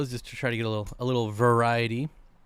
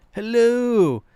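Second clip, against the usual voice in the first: higher, louder put-on hello; a bad imitation of the fish seller's greeting; Dan's Fish impersonation; 10 semitones higher, 8 dB louder than usual. Streamer doing a poor imitation of Dan greeting. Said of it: "That's my Dan's Fish impersonation, but it's not very good".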